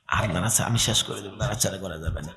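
very soft, low undertone; quiet aside. Real, not acted. Preacher aside to helpers fidgeting nearby, quiet low undertone. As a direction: drop right down for a private word.

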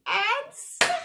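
sudden loud high snap. Acted. Crocodile snapping at a monkey, loud burst. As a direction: bite the word off suddenly.

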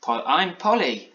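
high, slow, stammered start; mildly awestruck little girl; Polly in wonder. A narrator's reading. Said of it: "replied Polly in wonder".

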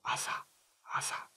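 voiceless whispered アザ, very soft; アザ in a hushed, careful whisper. Shown as a demonstration. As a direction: whisper アザ as a sound demonstration. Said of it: "アザをささやき声で発音すると".

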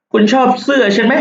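playful high-voiced question; a light girl's voice asking about her dress; high girly question.

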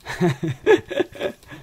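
short chuckle in a quick run; laughing. Real, not acted. Player laughing to himself mid-game, amused. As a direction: break into a brief real laugh.

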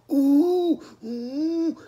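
very high falsetto ooh ooh moans; mocking moans. Retelling what he heard in the dorm, high mocking moans. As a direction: mimic a man moaning, squeaky and high. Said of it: "just a moaning".

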